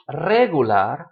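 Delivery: "regular" in Spanish as RE-gu-lar, RE punched loud; stress on first syllable. Shown as a demonstration. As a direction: hit the RE of "regular". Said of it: "which syllable to stress".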